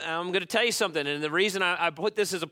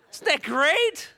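laughing delight; 12 semitones higher, 4 dB louder than usual. Real, not acted.

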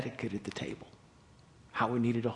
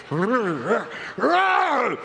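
laughing; 12 semitones higher, 10 dB louder than usual. Real, not acted. Laughs at the scene.

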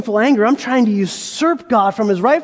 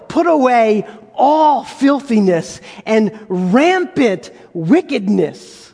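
slow scripture; 2 semitones lower, slower than usual. Acted. Reading scripture slowly.

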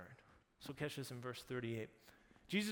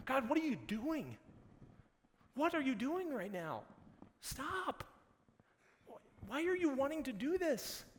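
high, loud, acted-out exasperation; exasperated protest; 10 semitones higher, 4 dB louder than usual. Standing back with Martha, questioning God, exasperated protest. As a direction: demand an explanation in a raised, higher voice.

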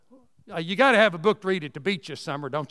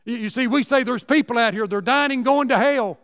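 ordinary talk

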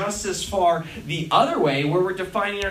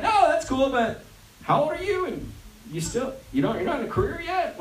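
higher-pitched fake-polite probing; mock condescension; 5 semitones higher than usual. Acquaintance asking about his lack of career, mock condescension. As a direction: question them with put-on niceness.

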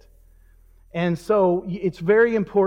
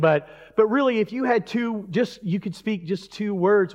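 ordinary talk

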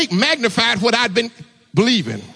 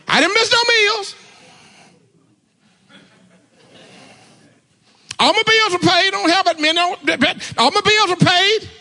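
higher, fired-up preacher calling on the church; preaching at full force; 10 semitones higher than usual. Acted. Preacher testifying to the church, fired-up preaching. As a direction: rouse the congregation, testify high and strong.

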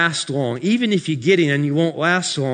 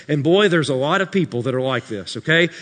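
normal talking voice

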